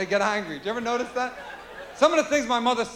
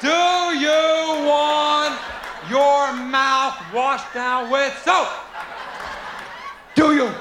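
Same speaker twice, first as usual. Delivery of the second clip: slow shouting mother; 2 semitones higher, much slower than usual. Retold or imitated. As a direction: bellow it slowly like an enraged mom. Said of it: "And they keep repeating it".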